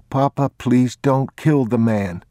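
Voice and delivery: put-on a frightened boy begging his papa; pleading boy. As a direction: beg your father to spare him.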